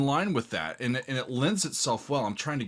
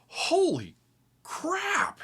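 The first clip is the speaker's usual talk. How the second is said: breathless awed whisper, the second word much higher; real astonishment slipping out under the breath; amazed gasp; much slower than usual. Real, not acted. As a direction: awestruck. Reacting amazed to the song.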